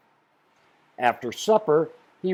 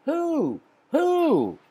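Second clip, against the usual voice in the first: high, puzzled repeated who; wondering who; 14 semitones higher than usual. Acted. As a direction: ask it high and bewildered, to the air. Hatch wonders who sent valentine.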